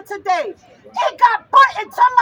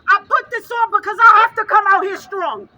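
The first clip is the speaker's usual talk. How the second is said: ordinary talk, nothing marked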